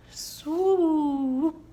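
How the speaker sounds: long swooping gliding swoop; swooping starling. Shown as a demonstration. Librarian acts out a starling's swoop for children, gliding. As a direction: glide the word like a bird diving. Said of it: "swoop like a starling".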